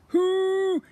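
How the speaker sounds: high loud trumpeting hoot; elephant hoot. A narrator's reading. Narrator trumpets the gunk elephant's hoot. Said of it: "It hooted".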